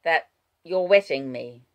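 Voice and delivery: ordinary delivery, nothing marked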